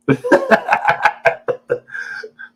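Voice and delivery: long high laugh tailing off; drawn-out amused cackle. Real, not acted. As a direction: burst out in a high, breathy laugh. Laughs after teasing the late arrivals.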